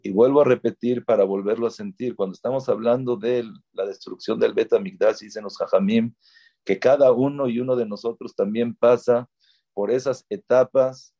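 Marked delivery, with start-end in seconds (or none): none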